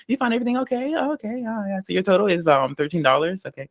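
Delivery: high, sing-song customer-service voice; chirpy American cashier. Retold or imitated. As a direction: play an overly friendly checkout clerk.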